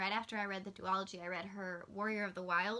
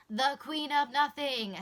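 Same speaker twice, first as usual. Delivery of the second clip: loud, showy title call in a put-on voice; dramatic announcer; 5 semitones higher, 8 dB louder, slower than usual. Repeating the book title to the camera, hammed-up announcer. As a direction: proclaim it like a movie trailer.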